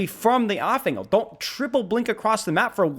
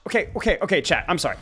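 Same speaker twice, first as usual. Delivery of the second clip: ordinary delivery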